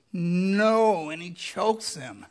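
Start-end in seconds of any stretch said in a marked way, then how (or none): 0.1-1.1 loud flat no; harsh refusal. Retold or imitated. Servant refusing his debtor's plea, a loud harsh no. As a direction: slam the refusal down. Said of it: "And he goes".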